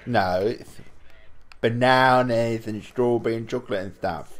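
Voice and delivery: normal talking voice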